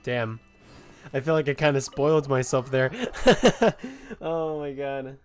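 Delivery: normal talking voice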